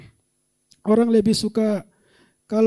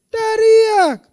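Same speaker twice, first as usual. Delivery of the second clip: shout; 11 semitones higher, 8 dB louder than usual. Shown as a demonstration. Preacher demonstrates the shout.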